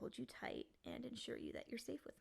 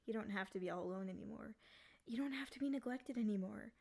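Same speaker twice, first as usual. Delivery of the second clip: usual speaking voice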